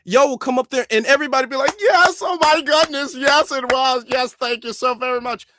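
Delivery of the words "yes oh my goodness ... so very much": high, loud burst of imitated adulation; mock gushing. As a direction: gush in an exaggerated fan's voice. Mimicking gushing praise of accented speakers.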